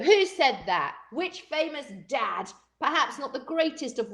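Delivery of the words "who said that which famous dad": ordinary talk, nothing marked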